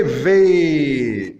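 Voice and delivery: long, emphatic "V", falling away; demonstrated sound. Shown as a demonstration. Teacher concluding how Fao is pronounced here, demonstrated sound. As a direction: stretch "V" out with a voiced v. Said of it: "le fao s'est prononcé".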